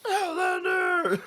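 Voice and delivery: very high, slow monster wail; Cookie Monster voice. Retold or imitated. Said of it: "I just gotta repeat that".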